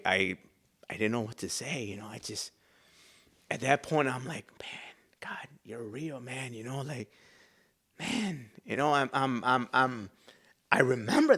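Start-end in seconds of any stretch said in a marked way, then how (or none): 4.6-6.5 very soft, breathy realization; awed whisper. Retold or imitated. Speaking to God at that point, awed whisper. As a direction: whisper it in awe.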